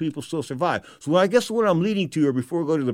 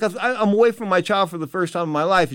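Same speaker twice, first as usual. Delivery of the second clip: ordinary talk, nothing marked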